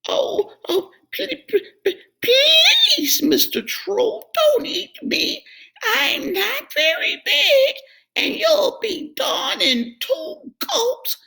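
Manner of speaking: very high, trembling, pleading goat; squeaky goat stammering in fear; frightened goat. A narrator's reading. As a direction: beg the troll for mercy, quaking. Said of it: "Middle Billy Goat Gruff wobbled".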